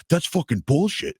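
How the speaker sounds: retold indignant cursing, only mildly heated; mock angry fan. Drunk fan swears at the volunteer by the elevator, mock angry.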